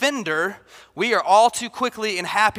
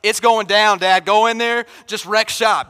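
put-on louder, eager little boy urging dad on; kid demanding justice; 7 dB louder than usual. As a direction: egg Dad on to go punish them. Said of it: "He's like".

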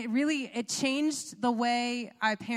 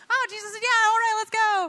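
high, loud, hyped-up mimicry; gung-ho excitement; 12 semitones higher, 9 dB louder than usual. Couple mocking their own impulsive yes, gung-ho excitement. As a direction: whoop it out like an eager volunteer.

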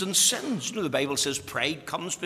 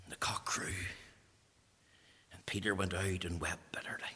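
whispered, dropping to almost nothing; hushed whisper; 8 semitones lower, 9 dB quieter than usual. Acted. Preacher hushing the congregation at Peter's fall, whispered. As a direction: breathe it like a grave secret.

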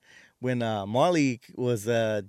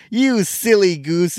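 much higher, louder, slow cartoon scold; 8 semitones higher, 10 dB louder, slower than usual. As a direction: wag a finger at the cartoon villain. Scooby-Doo reveal parody.

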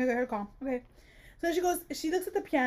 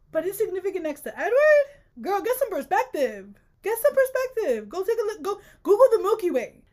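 exasperated rant; 7 semitones higher, 5 dB louder than usual. Real, not acted.

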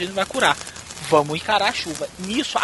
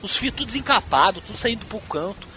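ordinary talk, nothing marked

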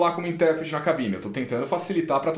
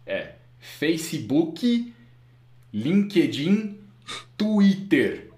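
put-on very slow, Brazilian-accented brand names; Facebook, LinkedIn, Twitter sounded out syllable by syllable in Brazilian Portuguese; Brazilian Portuguese pronunciation; much slower than usual. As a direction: spell it out with heavy Brazilian vowels. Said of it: "Fala português direito".

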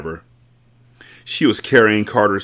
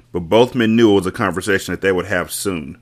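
ordinary talk

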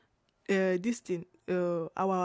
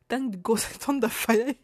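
louder, laughing imitation of an angry director; mock-mad director; 3 semitones higher, 6 dB louder than usual. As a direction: play the director's outrage, cracking up. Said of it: "the director was so mad".

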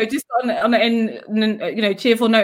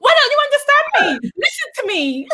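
high-pitched mock outburst, imitating a frustrated child; angry child; 13 semitones higher, faster than usual. Mother imitating her son losing it at Google, angry and exasperated. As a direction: wail it in exaggerated childish exasperation. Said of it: "He's getting so angry at this thing".

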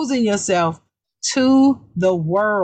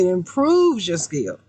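ordinary delivery

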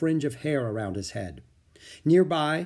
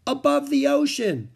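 much higher, louder holler; calling out; 6 dB louder than usual. A narrator's reading. Girl outside calls back to Jack, calling out. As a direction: call it out from outside. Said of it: "Annie called from outside".

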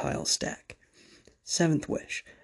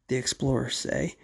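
ordinary talk, nothing marked

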